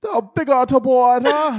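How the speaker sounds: a Jamaican patois impression, hammed up; mock patois. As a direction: loose Jamaican patois put on for laughs. Imitating [name]' patois.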